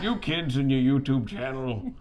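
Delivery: put-on grumpy old woodpecker grumbling; lightly voiced grouchy old bird; curmudgeonly old woodpecker. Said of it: "Like old curmudgeonly woodpecker".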